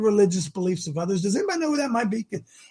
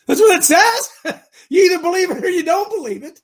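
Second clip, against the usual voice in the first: laughing through the words; 9 semitones higher, 4 dB louder than usual. Real, not acted. Radio host laughing at his own point. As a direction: chuckle while making the point.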